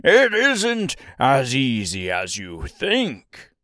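slow, rough jeering voice; harsh taunt. A narrator's reading. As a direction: taunt them harshly, drawing it out. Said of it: "laughed harshly as he said".

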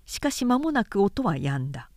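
usual speaking voice

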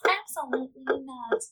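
lightly nasal mock of a rude contestant; nasal Jessica impression. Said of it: "I'm gonna try and do a Jessica impression".

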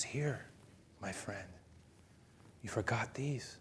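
very soft, kindly offering; tender, hushed. Acted. Bishop handing candlesticks to the man who robbed him, tender hush. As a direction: give it softly, with pauses between phrases.

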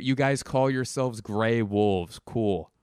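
ordinary delivery

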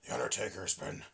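low, lifeless, airy mock speech; mocking Vince's flat, croaking voice at an induction; Vince's dead voice. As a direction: drone it out like a tired old boss. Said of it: "his fucking dead voice".

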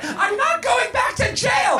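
high, slow outraged shout; frantic refusal. A narrator's reading. Missus Garrett refuses to risk jail, frantic refusal. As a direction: shout it high in hammed-up panic.

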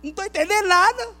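confissão de fé alta e arrastada, voz subindo; proclamação dramática. Acted. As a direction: proclame bem alto, esticando as palavras. (proclamação exaltada de fé)

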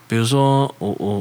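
usual speaking voice

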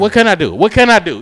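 loud, high, snappy comeback; irritated retort. Retold or imitated. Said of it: "I'm getting irritated".

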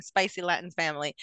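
ordinary talk, nothing marked

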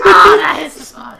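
squeaky high and loud, laughing; helium voice. Pretend. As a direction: chirp it like you just huffed helium. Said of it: "Now you sound like this".

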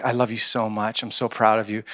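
soft, low, heartfelt affection; tender. Retold or imitated. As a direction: say it gently, close to a small child. Uncle tells niece he loves her.

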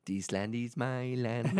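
lightly put-on Mexican accent, slowed. Friend sings a folk song in a Mexican accent. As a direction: sing the line slowly in a light Mexican accent. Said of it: "you doing a Mexican voice".

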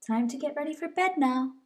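plain reading voice

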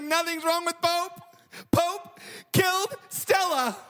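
slow, high-pitched mock wail; imitated terrified daughter. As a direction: drag out the dreadful news as a distraught child. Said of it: "hear that kind of terror on the phone".

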